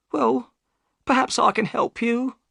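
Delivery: high, worried woman's voice; the boatman's anxious wife; high worried voice of Mrs Smith; upset wife. Acted.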